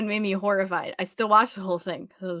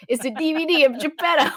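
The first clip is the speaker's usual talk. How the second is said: louder, higher mock presentation; playful, raised-voice reenactment; imitated gift reveal; 6 semitones higher, 5 dB louder than usual. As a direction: present it like a joke prize. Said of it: "Greg gives Wayne just, like".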